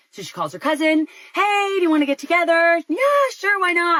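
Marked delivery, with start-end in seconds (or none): 1.3-2.8 high, louder chirpy phone greeting; cheery invitation. Retold or imitated. 2.9-4.0 very high, breezy phone reply; cheery acceptance. Retold or imitated.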